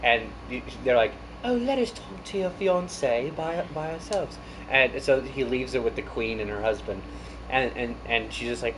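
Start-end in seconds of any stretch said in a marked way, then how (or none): 1.4-4.4 high, soft elegant British royalty; elegant British accent. Retold or imitated. Royals to son about his fiance, elegant British accent. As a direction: imitate refined British royals, softly. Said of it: "like, the elegant British accent".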